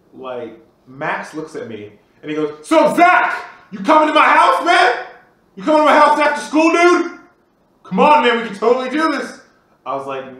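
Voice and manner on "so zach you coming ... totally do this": much higher and louder, pleading fast; excited begging. Retold or imitated. Friend begging Zach to come over, high excited pleading. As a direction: bounce up high and beg your buddy.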